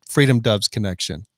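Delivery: normal talking voice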